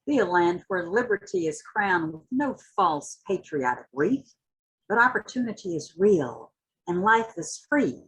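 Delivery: rising, impassioned spoken-word reading; dramatic poetry reading with swelling intensity; poem recitation. Acted. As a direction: recite it like a rallying cry, building toward the end. Recites Hughes poem after introduction.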